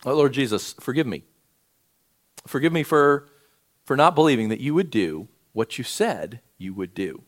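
heartfelt pastoral prayer. Acted. Leads a closing prayer.

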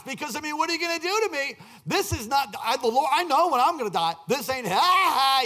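high, acted defiance ending in ha-ha; imitated joyful laughing. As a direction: play a man laughing at his attackers. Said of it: "gets the joy of the Lord and starts laughing".

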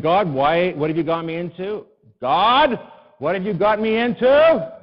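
loud, high, annoyed demand; ticked off. Retold or imitated. Pastor loudly confronting God, a little ticked off. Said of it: "now I feel a little ticked off".

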